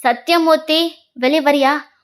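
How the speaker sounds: loud call from outside the house; yelling for someone to come out; shouting out. Acted. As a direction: call him out, loud and angry. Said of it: "அப்படின்னு கத்துறான்".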